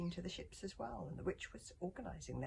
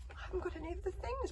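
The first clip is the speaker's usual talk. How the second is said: put-on high boy's protest; 4 dB louder, faster than usual. A narrator's reading. Son protesting to his warrior mother, high voice. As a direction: pipe up in objection. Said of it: "protested Hiccup".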